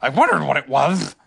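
curious creature with its own timbre; Tigger. A narrator's reading. Tigger learns what attacked him, loud character voice. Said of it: "said Tigger".

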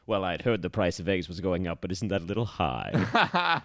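a low, lightly British Bond voice; dry Moore quip, lightly put on; imitating Roger Moore. [name] quoting Moore's line from Octopussy in a mild British accent. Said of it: "And Roger Moore says".

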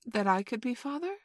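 put-on high, rising childish question; defiant. A narrator's reading. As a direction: ask with a touch of defiance. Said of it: "Toodle said defiantly".

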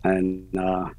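usual speaking voice

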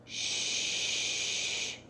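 soft hushing shh; whisper. Acted. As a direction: hush them in a whisper. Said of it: "the woman whispered".

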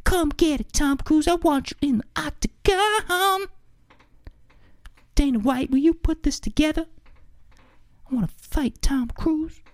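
high squeaky taunting voice. Retold or imitated. Mock [name] singing then taunting.

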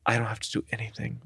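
hushed, quick and relieved; quiet relieved aside. Retold or imitated. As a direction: murmur it to yourself quickly. Retold inner relief.

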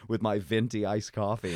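loud boast through laughter; mock bragging. Joking boast about beating an old man, laughing loudly. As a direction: brag absurdly, laughing as you go. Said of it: "It would be nothing to brag about".

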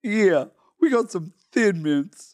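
slow, drawn-out diva impression. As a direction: stretch it out like a pop diva. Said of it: "we're gonna retire Miss [name]".

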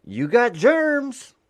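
high, loud, slow sing-song; mock slogan. Mailman tries out a slogan, high and drawn out. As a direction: stretch it out high like a jingle. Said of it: "No, that's not gonna work".

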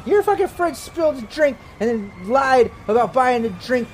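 put-on high, drunk accusing girl. GM voicing drunk girl blaming the player. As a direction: slur the blame at him, way up high.

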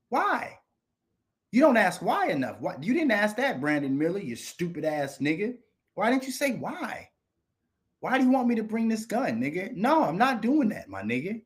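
high, incredulous questioning; exasperated scolding. Real, not acted. Telling off a player who never asked why, exasperated and high. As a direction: scold in a high, pleading pitch.

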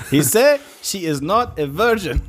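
slow, high put-on delivery; movie voice. Quoting movie scene, laughing.

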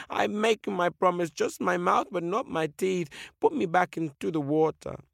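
high, earnest creature voice; pleading whale. Acted. Whale begs the eagle for the water, high pleading voice. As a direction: plead and promise.

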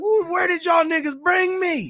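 high, slow, loud disbelieving shout. Retold or imitated. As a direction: yell it in stunned disbelief. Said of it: "I was like".

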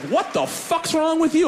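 confused angry stranger; incredulous driver. Retold or imitated. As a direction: spit it out in disbelief.

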